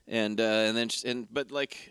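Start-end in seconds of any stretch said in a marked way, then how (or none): none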